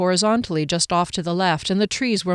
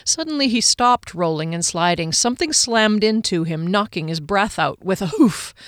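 ordinary delivery

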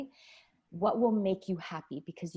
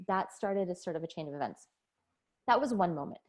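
usual speaking voice

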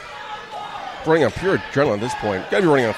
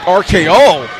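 excited shout; 6 semitones higher, 9 dB louder, slower than usual. Acted. Commentator calling finisher.

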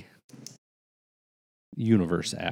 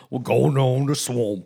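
mock Louisiana accent; 3 semitones higher, 3 dB louder than usual. Joins the accent bit.

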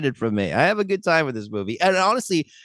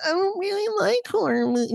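whiny, high-pitched mocking voice; mocking naysayer. Host mimicking people who skip the horror movie, mocking high voice. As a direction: imitate a reluctant viewer way up high. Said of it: "If you're like".

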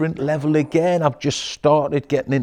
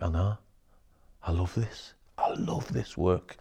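quiet sincere; 5 semitones lower, 9 dB quieter than usual. Retold or imitated. Coach quoting his own quiet reply to a client, sincere. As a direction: say it gently. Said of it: "And I'm like".

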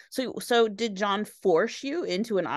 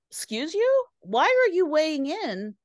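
high-pitched indignant challenge, acted out; offended objection; 7 semitones higher, 4 dB louder than usual. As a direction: play an affronted bandmate cutting someone off. Acting an offended bandmate's objection.

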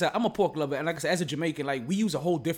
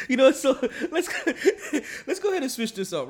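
talking through real laughter, higher; laughing; 9 semitones higher, 4 dB louder than usual. Real, not acted. Host trying to switch topics after a laugh, still laughing. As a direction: laugh while trying to move on.

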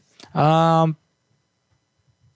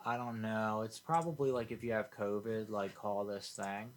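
hushed; 21 dB quieter than usual. Real, not acted. Talking low near live call.

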